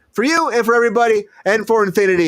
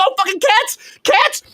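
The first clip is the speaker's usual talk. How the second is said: mock yell; 9 semitones higher than usual. Retold yell at cats.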